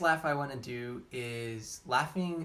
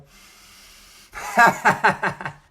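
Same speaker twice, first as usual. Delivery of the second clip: big roaring belly laugh, tongue out; loud lion-mane laugh from the belly; lion's laugh; 6 semitones higher, 15 dB louder than usual. Shown as a demonstration. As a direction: lean forward and let a loud lion laugh roar out. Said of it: "This is the lion's laugh".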